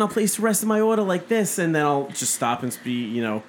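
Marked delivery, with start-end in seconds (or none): 0.0-1.6 lightly put-on Long Island accent, pitched up. Shown as a demonstration. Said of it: "I can do a really strong Long Island accent".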